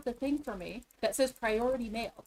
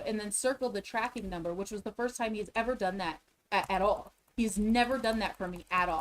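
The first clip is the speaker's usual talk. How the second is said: usual speaking voice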